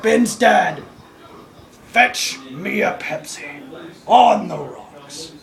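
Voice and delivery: slow, drawn-out grand command; low, lingering, hammed-up demand; mock lordly order. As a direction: order a drink like pretend nobility.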